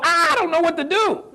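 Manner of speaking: high, whiny, mock-panicked wail. Mocking entrepreneurs who freeze at real estate, high mock panic. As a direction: play the helpless beginner. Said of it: "and we go".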